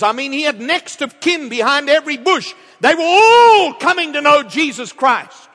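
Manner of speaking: loud, high-pitched revival preaching; exultant preacher in full cry; full-force preaching. Real, not acted. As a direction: build to a triumphant shouted climax. Preaches at full force, building to climax.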